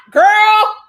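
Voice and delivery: big gossipy outburst; exclamation. Real, not acted. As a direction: exclaim it in loud disbelief. Exclaiming at the gossip she recounts.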